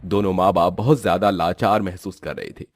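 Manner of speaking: ordinary delivery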